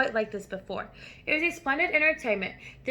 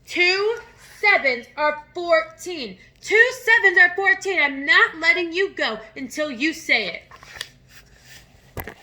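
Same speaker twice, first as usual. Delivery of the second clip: louder, higher roared sum; bellowing; 6 semitones higher, 6 dB louder than usual. Acted. Headmistress bellowing at the dangling boy, loud and furious. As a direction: bellow the answer into him. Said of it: "Miss Trunchbull bellowed".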